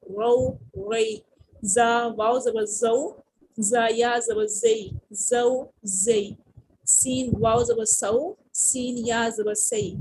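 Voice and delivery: slow, rhythmic teacher's letter-by-letter reading; slow spelling drill. Shown as a demonstration. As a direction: spell each letter then join them, unhurried.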